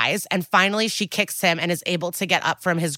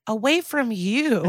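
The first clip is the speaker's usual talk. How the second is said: retort; 5 semitones higher than usual. Retold or imitated. Retold retort to her attacker.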